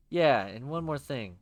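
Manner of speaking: usual speaking voice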